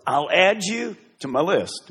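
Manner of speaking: usual speaking voice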